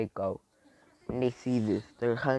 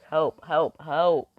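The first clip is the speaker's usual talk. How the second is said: urgent, raised, repeated calls; cry for help; 5 semitones higher, 9 dB louder, much slower than usual. Acted. Mama bear pleading to the wolves for her cubs, urgent cry. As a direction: call out loudly and high for help.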